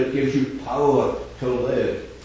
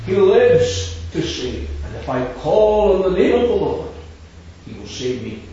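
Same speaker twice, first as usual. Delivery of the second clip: preaching at full force; 4 semitones higher, 5 dB louder than usual. Acted. Preacher to the meeting, urging them to call on the Lord, full-force proclamation. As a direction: hammer home the call to the Lord.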